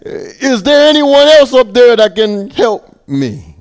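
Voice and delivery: very high, loud desperate yell; man refusing to let go, yelling for other help. Acted. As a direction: scream up for anyone else. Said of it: "finally Jack yelled".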